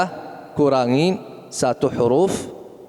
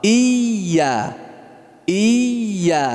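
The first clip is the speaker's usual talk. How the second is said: ordinary delivery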